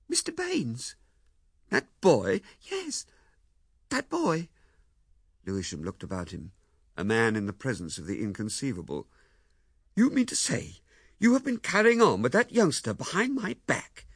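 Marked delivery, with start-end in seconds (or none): 0.1-0.9 wife's voice. A narrator's reading. Wife names the sender. 1.7-2.4 put-on louder, falling disbelief at the boy; incredulous. A narrator's reading. Husband hearing the sender's name, loud incredulous echo. 2.6-4.5 a small high admission; wife confirming. A narrator's reading. 10.0-14.1 accusing. A narrator's reading. Husband accuses wife.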